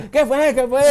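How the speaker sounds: mock heckling friends. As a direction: lightly imitate mates goading a shy friend. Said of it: "adelante y ustedes".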